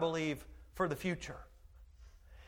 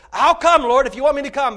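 loud, high eager call; Peter calling out; 7 semitones higher, 14 dB louder than usual. Acted. Peter calling out to Jesus on the water, loud and eager. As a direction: Peter shouts his eager offer across the water.

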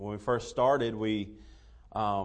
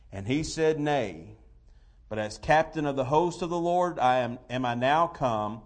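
scripture reading; 2 semitones higher, 4 dB louder than usual. Real, not acted. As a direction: read the King James verses with weight. Reads King James scripture aloud.

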